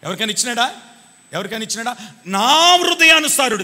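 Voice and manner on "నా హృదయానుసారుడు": loud, high and drawn out, first word stretched; ringing proclamation. Retold or imitated. Preacher announces God's verdict on David, proclaiming loudly. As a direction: proclaim it to the congregation.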